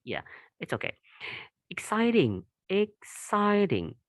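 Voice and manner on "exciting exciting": exciting said high and slowly, drawn out; slow syllable demo. Shown as a demonstration. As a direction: stretch exciting out to show three syllables. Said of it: "it has three syllables".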